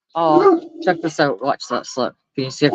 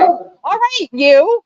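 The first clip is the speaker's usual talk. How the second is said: scolding the dog; 10 semitones higher, much slower than usual. Real, not acted. Calling her misbehaving dog.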